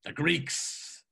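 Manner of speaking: slow, theatrical declamation; drawn-out, grand quoting voice; rhapsodic Mulligan. Acted. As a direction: savour each phrase with mock-poetic relish. Mulligan declaims about the sea.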